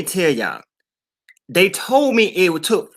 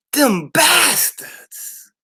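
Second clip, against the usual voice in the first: fake sobbing; 11 semitones higher, much slower than usual. Slow fake sob.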